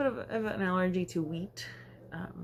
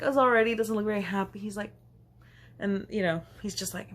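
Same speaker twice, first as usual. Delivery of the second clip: ordinary talk, nothing marked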